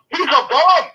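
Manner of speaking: fired up. Real, not acted. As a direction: spit it out in real anger. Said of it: "I'm getting really fired up about this".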